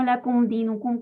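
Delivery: lakum dinukum blurred into one word; run together. Shown as a demonstration. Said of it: "como si fuera una sola palabra".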